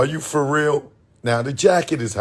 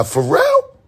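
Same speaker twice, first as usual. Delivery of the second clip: shocked high squeak on the name; surprised. Retold or imitated. Realizing the Happy Guy is [name], a high shocked squeak. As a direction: shoot way up in disbelief.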